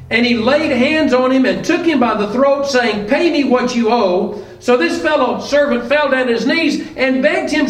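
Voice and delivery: scripture reading. Acted. Preacher reads the parable of the unforgiving servant, formal reading. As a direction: read the Bible passage aloud to the congregation.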